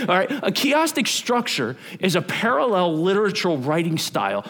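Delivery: ordinary talk, nothing marked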